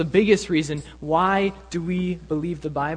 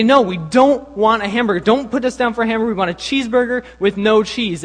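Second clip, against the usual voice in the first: higher, louder imitated indignant customer; mock protest; 5 semitones higher, 7 dB louder than usual. Prank customers refuse a hamburger, loud mock indignation. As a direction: argue back with hammed-up insistence. Friends protest again to the cashier.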